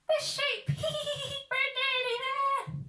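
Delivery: high loud crook; giggly crook voice; high robber voice. Acted.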